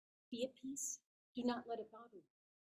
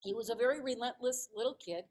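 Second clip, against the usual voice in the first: normal talking voice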